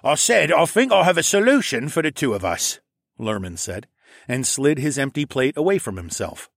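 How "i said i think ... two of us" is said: higher, louder scheming captain; pirate captain voice. Acted. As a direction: lean in like a sly pirate.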